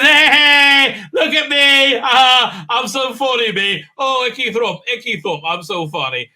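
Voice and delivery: put-on high, loud childish jeering voice that fades at the end; mocking taunt. King jeering at the witchfinder, loud mocking taunt. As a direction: ham up a gloating tease.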